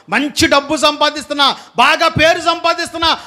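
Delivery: high, urgent preacher's appeal; fervent preaching. Acted. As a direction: press the congregation with each question.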